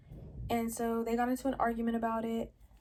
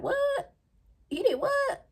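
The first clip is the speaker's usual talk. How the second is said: high, loud, stunned "What? He did what?"; retelling her shock, voice jumping way up; shocked disbelief; 5 dB louder, slower than usual. Retold or imitated. Hearing the police call news.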